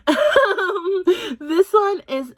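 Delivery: laughing through the words; giggling at herself. Real, not acted. Laughs at own accent slip.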